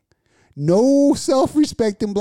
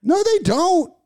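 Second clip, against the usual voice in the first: much higher, raised voice; high, insistent protest; raised-voice contradiction; 4 dB louder than usual. Real, not acted. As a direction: shoot back a high-pitched denial. Loud playful dispute over teeth.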